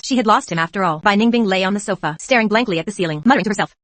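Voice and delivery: usual speaking voice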